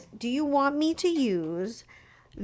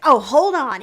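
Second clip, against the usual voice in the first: mock upset; 4 semitones higher, 10 dB louder than usual. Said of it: "She got, like, upset".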